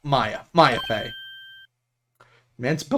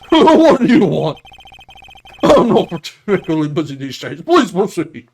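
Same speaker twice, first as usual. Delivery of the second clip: pompous big-man lawyer; 3 semitones higher, 8 dB louder than usual. Acted. Lawyer invites his visitor to proceed, booming and grand. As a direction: play a self-important attorney.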